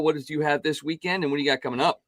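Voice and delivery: ordinary talk, nothing marked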